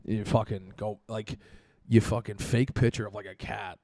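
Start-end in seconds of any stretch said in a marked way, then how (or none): none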